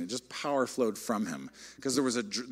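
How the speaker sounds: usual speaking voice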